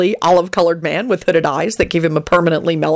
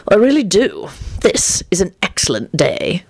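ordinary delivery, nothing marked

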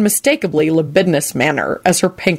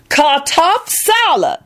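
much higher, slow, pointed; loud Lucretia; 10 semitones higher, slower than usual. A narrator's reading. Lucretia correcting Solange, high and slow. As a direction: correct it slowly and proudly. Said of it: "Lucretia informed them loudly".

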